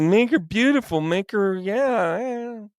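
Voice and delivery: high, drifting, dreamy mumbling voice; half-sedated Adam. Acted. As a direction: trail off as if going under sedation. Sedated Adam murmurs requests.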